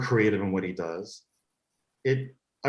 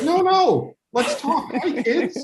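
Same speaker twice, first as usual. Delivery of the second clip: much higher, louder delighted hello; cheery welcome; 5 dB louder, much slower than usual. Retold or imitated. Actress imitated inviting the kids to stay and chat, much higher and louder delight. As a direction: gush over the kids like a playful aunt.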